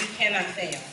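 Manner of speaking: usual speaking voice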